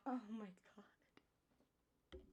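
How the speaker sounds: breathless shocked whisper. Real, not acted. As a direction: gasp it under your breath. Shocked at own joke.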